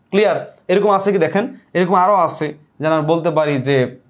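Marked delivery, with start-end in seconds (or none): none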